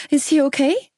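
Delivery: demanding. Acted. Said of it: "I demanded".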